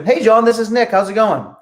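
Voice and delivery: caller greeting the seller warmly, high and upbeat; upbeat friendly greeting. Shown as a demonstration. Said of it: "Eh, opposed to".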